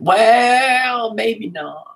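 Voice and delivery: a fond, imitated version of his accent; a friendly imitation of the teacher's regional accent; Andy Duncan's accent. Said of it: "in his inimitable accent".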